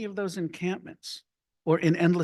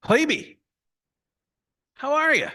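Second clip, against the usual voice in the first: high, louder retold welcome; beaming hello to Herbie; big happy greeting; 12 semitones higher, 5 dB louder than usual. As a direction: greet him like you're hugging him. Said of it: "And I'd say".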